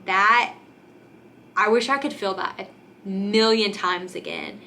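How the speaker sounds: ordinary talk, nothing marked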